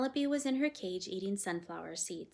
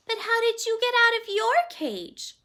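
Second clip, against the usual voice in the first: put-on timid girl; 14 dB louder than usual. A narrator's reading. Girl confessing her fears to the parrot, timid. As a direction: say it like a frightened child.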